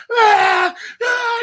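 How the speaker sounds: high strained yelling grunts; imitated lifter roar. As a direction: roar like a powerlifter psyching up. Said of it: "And he's like".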